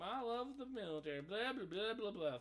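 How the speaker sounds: high, silly parody voice trailing into blah blah; mock soldier. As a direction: ham up the patriot then wave it off. Mocking soldier voice, dismissive.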